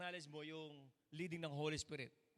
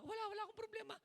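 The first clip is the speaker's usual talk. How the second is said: mock denial; 3 dB quieter, much faster than usual. Acted dialogue about sadness.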